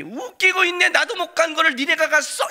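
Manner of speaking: high, loud scoffing taunt; mocking jeer. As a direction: sneer at the boy. Friends mocking disbelief.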